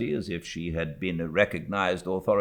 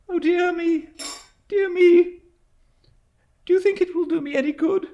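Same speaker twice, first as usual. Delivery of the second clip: higher and hurried, mildly helpless; helpless cry; 8 dB louder than usual. A narrator's reading. Frightened widow to stern aunt, mild helpless cry. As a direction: plead weakly for comfort. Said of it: "cried my mother in a helpless manner".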